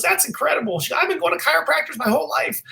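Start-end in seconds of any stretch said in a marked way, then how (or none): 0.0-0.8 put-on very high-pitched elderly New York accent. Elderly customer spots chiropractor's shirt, New York accent. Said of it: "in her New York accent". 1.0-2.6 put-on very high-pitched elderly New York accent. Elderly customer spots chiropractor's shirt, New York accent. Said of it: "in her New York accent".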